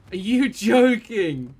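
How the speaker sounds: loud, slow disbelieving shouts; excited outburst. Real, not acted. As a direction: roar with delighted disbelief. Incredulous outburst at huge damage.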